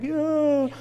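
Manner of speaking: very high drawn-up cheer; mock triumph. Dad showing off to son on the wave, mock triumphant squeal. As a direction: let it shoot way up in mock victory.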